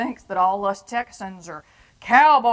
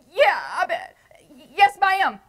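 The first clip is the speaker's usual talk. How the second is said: high, timid yes ma'am; scared kid; 8 semitones higher, 5 dB louder than usual. Retold or imitated. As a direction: answer like a cowed boy. Scared kid answering her.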